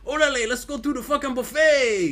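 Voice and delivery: exaggerated mock Mexican accent, pitched high.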